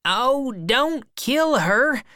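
high, drawn-out protest; alarmed shout. A narrator's reading. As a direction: cry out to stop him, slow and high. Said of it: "shouted the other man".

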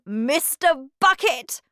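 high, loud, cheery hello; hearty greeting. A narrator's reading. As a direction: greet a stranger with gusto. Wonka greets father amid the wreckage.

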